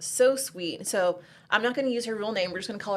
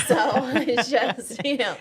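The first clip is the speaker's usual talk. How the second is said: laughing; 4 semitones higher, 7 dB louder, much slower than usual. Real, not acted. Laughing at the cussing joke.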